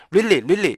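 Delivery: "really" as quick two-syllable rilli; wrong short REALLY. Shown as a demonstration. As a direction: drop the middle beat of "really". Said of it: "이렇게 읽으시면 절대 안 돼요".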